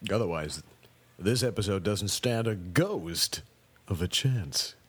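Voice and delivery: ordinary talk, nothing marked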